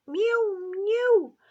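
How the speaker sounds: very high, loud mew; a cat crying to be let in. A narrator's reading. Simpkin mews at the shop door, high and loud. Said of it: "cried Simpkin".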